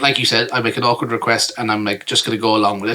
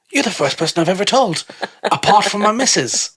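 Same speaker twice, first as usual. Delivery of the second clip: high, put-on English accent; mock English accent, slowing at the end; hammed-up confiding English bloke; imitated English bloke; 9 semitones higher than usual. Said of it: "this guy basically told me".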